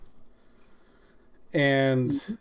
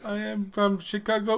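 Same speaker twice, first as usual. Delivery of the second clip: higher, put-on Indian accent; mock Indian accent; 7 semitones higher than usual. Insisting on a hometown, in a mock Indian accent. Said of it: "a Chicago in India".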